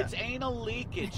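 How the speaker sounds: ordinary talk, nothing marked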